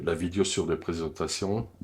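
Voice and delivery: normal talking voice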